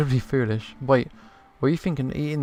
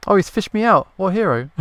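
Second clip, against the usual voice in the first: higher and louder, amazed and grateful; surprised delight; 6 semitones higher, 7 dB louder than usual. Real, not acted. Player reacting to the fisherman pulling him from the river. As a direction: gasp it out, much louder and higher.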